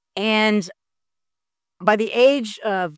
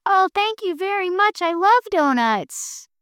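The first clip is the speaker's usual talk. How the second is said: much higher, tiny grateful voice; delighted little guy; 11 semitones higher than usual. Acted. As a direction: gush thanks like a tiny creature. Little guy thanks Panda.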